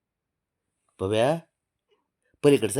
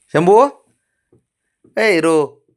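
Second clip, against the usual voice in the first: loud high call of a name; 6 semitones higher, 10 dB louder, slower than usual. Real, not acted. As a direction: call the dog from across the house. Calling the dog by name. (मोठ्याने हाक मारणे)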